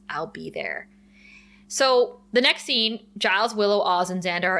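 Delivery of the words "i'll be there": low and soft, understated threat; lightly voiced brooding Angel, hushed; quiet brooding. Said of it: "and Angel says".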